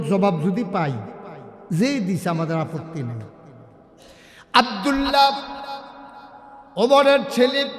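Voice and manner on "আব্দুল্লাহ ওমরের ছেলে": high, loud proclamation; dramatic announcement. A narrator's reading. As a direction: call the name out to the crowd. Announces the son with raised voice. (উঁচু গলায় নাম ঘোষণা)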